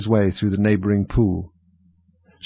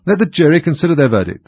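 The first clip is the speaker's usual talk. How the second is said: high, loud king; 7 semitones higher, 6 dB louder than usual. A narrator's reading. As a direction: order it wearily like a king. King repeats order.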